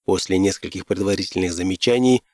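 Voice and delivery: ordinary delivery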